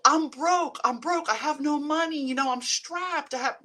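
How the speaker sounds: high, loud whining about money; whiny broke complaint. Retold or imitated. Vlogger mocking money complaints to her viewers, high whiny moan. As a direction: whine like you're always short of cash. Acts out negative self-talk.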